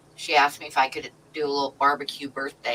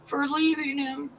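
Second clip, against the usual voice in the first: mock crying; 12 semitones higher than usual. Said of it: "she's like, crying".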